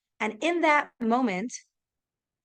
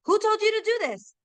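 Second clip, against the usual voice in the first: much higher furious demand; angry king; 10 semitones higher, faster than usual. Acted. King confronts the men in his garden, angry and high. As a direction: bark it at the tree cutters, pitched high. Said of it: "And Achashverosh got so angry".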